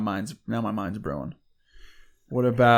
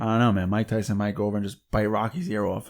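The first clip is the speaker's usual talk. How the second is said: ordinary delivery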